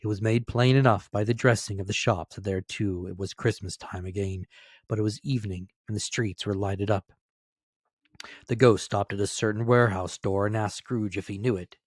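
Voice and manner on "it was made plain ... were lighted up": ordinary delivery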